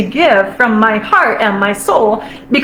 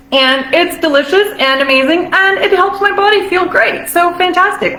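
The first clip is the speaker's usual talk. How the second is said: higher, glowing with delight; a joyful, higher gush of praise; lit-up abundant voice; 6 semitones higher than usual. Shown as a demonstration. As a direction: sound lit up from the heart. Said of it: "like, how it starts to sound".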